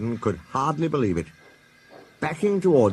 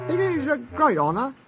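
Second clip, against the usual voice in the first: thoughtful. Acted. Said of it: "said Duck thoughtfully".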